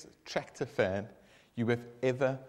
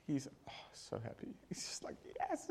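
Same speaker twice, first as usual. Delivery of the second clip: whispered, secret delight; hushed joy; 13 dB quieter than usual. Acted. Tractor fan starts the stolen tractor, hushed glee. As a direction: breathe the joy out quietly.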